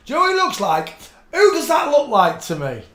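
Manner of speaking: high, loud and excited; excited shout. Real, not acted. Shouting at a photo she recognizes, excited. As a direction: burst out on recognizing a face.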